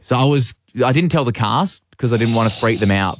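ordinary talk, nothing marked

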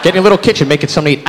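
ordinary talk, nothing marked

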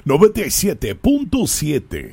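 booming Spanish DJ announce; Spanish radio voice. Shown as a demonstration. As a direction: roll it out like a Latin radio host. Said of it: "my voice changes there. That's my Spanish radio voice".

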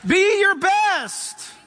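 slow, drawn-out shouted command; big slow rallying shout; rousing shout. Real, not acted. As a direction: call it out like a coach pushing a team. Urging the congregation.

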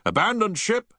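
loud, high repeated alarm; shouted. A narrator's reading. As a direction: shout it again over the tannoy. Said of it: "he repeated several times".

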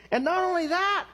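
very high, eager verse reading; excited reading. Retold or imitated. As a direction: read it out thrilled, pitch way up. Acting his excited first reading.

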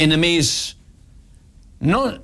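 normal talking voice

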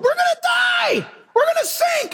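high shrieking cries of panic; panicked screaming. Retold or imitated. Disciples screaming in the boat as the storm rages, high panic. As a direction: shriek like terrified men in a storm. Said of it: "All the guys are crying in the boat".

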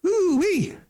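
high, loud delighted whoop; a squeal of glee; excited falsetto whoop; ooh wee. Retold or imitated. Impressed by pretty date.

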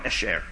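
esher, said low: ESH-er, falling; Hebrew word. Shown as a demonstration. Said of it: "E S H E R".

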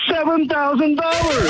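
real thrill, pitched high. Real, not acted. Caller telling the host his free silver total, thrilled. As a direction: announce a windfall with high, excited energy.

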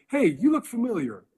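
high-pitched voice of a curious onlooker; mock a guy spotting someone famous; excited stranger. Acts out the man recognizing a celebrity.

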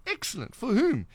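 high-pitched, animated quote; eager retold response. Host retelling his reply to the rally-goer, eager and high. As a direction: re-enact keen interest, voice lifted.

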